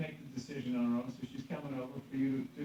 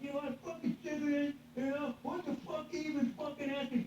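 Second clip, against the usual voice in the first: whiny rant; 11 semitones higher than usual. Retold or imitated. Mock exasperated rant, cry heard.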